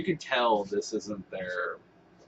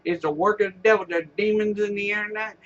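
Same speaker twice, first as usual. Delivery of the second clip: higher, louder hammed-up preacher; mock pastor; 10 semitones higher, 5 dB louder than usual. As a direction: sermonize like a frantic televangelist. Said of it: "But now he's like".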